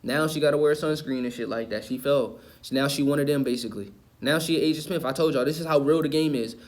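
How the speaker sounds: ordinary talk, nothing marked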